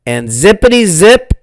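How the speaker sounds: ordinary delivery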